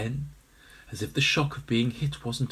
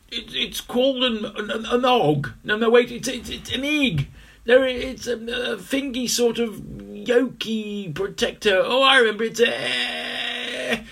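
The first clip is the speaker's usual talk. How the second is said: high, halting, stammering voice; excited stammer; 11 semitones higher, 5 dB louder than usual. Acted. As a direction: stumble over the words eagerly.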